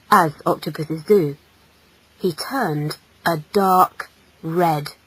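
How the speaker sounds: plain reading voice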